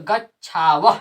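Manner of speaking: गच्छाव said with a breathy ह at the end; visarga pronounced. Shown as a demonstration. Teacher to class, showing the visarga as ह. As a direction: finish गच्छाव with an audible ह for the visarga. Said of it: "इसको विसर्ग को ह पढ़ते हैं".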